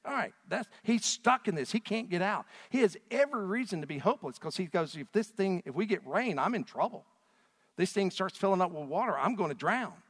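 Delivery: preacher working up the crowd; rising preaching. Acted. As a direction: pick up the fire as the danger grows. Preacher ramps up describing Jeremiah stuck.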